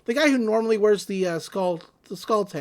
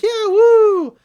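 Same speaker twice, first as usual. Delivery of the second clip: a much higher, louder whoop of joy; crowd cheering; 13 semitones higher, 12 dB louder than usual. Retold or imitated. Friends cheering at the garage, excited whoop. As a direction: cheer like a crowd heading to a concert. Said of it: "everybody's like".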